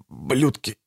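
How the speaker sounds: weak, soft, choked gurgle; gurgled curse. A narrator's reading. Dying Bertram with a heel on his chest, gurgling. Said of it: "пробулькал Бертрам".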